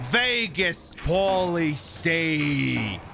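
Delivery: a light stretched-out Vegas drawl on the name; Vegas Pauly C voice. Shown as a demonstration. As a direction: lightly mimic a Las Vegas influencer announcing himself, very slow. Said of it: "I can even do an impression of him".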